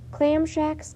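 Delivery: plain reading voice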